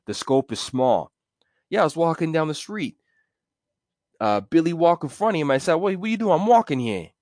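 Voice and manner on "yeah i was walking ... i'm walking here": high New York wiseguy telling a tale; mobster storytelling. Acted. Mobster retelling a street fight, wiseguy voice. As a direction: brag it short and blunt. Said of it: "when they're telling the story".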